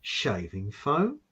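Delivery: slow, quizzical "Shaving foam?" rising at the end; mock the listener's baffled question; puzzled echo. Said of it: "Now you're thinking".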